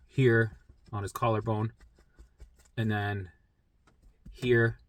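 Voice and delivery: usual speaking voice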